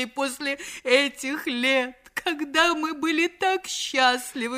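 melodramatic pleading, climbing high; wailing plea. Acted. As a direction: beg him to come back, drawing out the cries. Wife begs husband to return. (слёзная, надрывная мольба)

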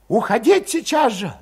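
high, loud, agitated voice; heated urging. A narrator's reading. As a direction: fire them up, high and urgent. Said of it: "возбуждающе горячился Валет".

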